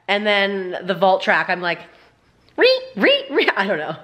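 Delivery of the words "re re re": very high comic squeal; weird screech. Retold or imitated. Vlogger mocking their crusty makeup look, weird high screech. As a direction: squeal it in short, silly high bursts. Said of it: "It's just me being weird".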